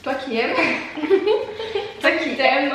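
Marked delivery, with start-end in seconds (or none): 0.6-2.0 rires aigus répétés; fou rire aigu. Real, not acted. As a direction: rire entre deux mots. (fou rire aigu)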